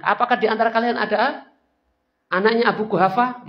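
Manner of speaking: loud high gloating call; shouted taunt. Acted. Abu Sufyan calling to the hiding Muslims, loud taunt. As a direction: yell the question across the field. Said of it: "Lalu dia teriak lagi".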